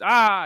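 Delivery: loud, high angry yell, imitated; sudden burst of rage out of nowhere; imitated frustrated "aah"; retold angry outburst. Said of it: "just in the middle of the day out of no".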